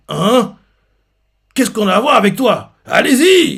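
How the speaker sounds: monte jusqu'à un cri très aigu et fort; ordre furieux. Retold or imitated. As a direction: lance ses hommes en criant. Superviseur ordonne l'attaque. (le chef crie à ses hommes d'attaquer)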